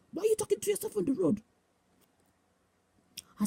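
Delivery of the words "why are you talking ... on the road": imitated scolding. Bishop appearing to woman on the road, imitated scolding. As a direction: rattle off a mock telling-off.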